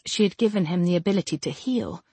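usual speaking voice

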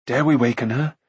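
usual speaking voice

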